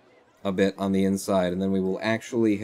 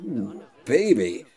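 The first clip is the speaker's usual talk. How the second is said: hammed-up sultry enjoyment; mock sensual pleasure; much slower than usual. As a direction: act turned on by the ache. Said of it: "started going".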